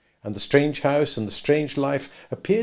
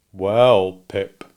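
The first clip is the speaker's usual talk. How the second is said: Joe's voice, thoughtful and slow; a lightly voiced, ponderous Joe; slow considering Joe; 3 semitones lower, 8 dB louder than usual. A narrator's reading. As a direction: weigh it up like a slow blacksmith. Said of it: "slowly considering".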